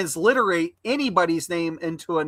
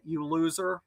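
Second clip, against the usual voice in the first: ordinary talk, nothing marked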